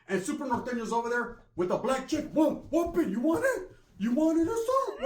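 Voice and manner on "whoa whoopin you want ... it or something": mock fight challenge. Super Norteño challenging, high.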